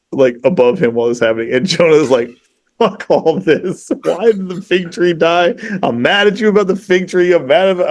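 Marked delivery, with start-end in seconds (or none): 2.8-7.9 higher-pitched mock indignant griping; pissed off. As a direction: act out a sulky, petulant complaint without shouting. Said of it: "he's just really pissed off".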